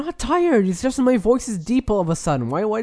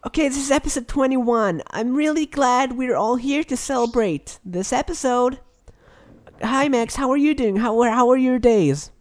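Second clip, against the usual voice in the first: pitched up, bright host voice; higher voice; 3 semitones higher than usual. Acted. Host restarts the show in a higher voice for his co-host. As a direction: lift the voice up for the show. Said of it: "I'm gonna talk in a, in a higher voice".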